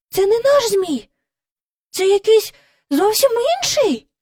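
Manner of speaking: high-pitched disbelief; astonished exclamation. A narrator's reading. Jane realising the kite is not theirs, astonished exclamation. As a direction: cry out in surprise, voice lifted high.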